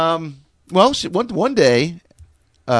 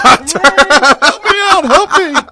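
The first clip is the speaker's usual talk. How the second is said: high, loud, very slow wail; mock plea for help; 12 semitones higher, 9 dB louder, much slower than usual. Pictures himself stuck in the toilet, high loud wail. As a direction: ham up a desperate cry. Imagines himself begging, drawn out.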